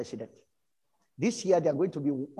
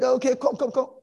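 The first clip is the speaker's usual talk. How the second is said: eager invitation; 9 semitones higher, 7 dB louder than usual. Retold or imitated. Rotary man urging him to come.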